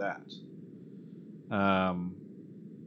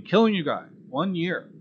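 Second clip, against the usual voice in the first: higher, louder name call-out; celebratory shout-out; 7 semitones higher, 4 dB louder than usual. Real, not acted. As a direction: lift the pitch to celebrate a subscriber. Shouting out a subscriber milestone.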